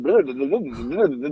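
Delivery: imitating a breaking-up phone line; mock garbled phone. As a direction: babble it like a bad connection. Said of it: "That's what you sound like".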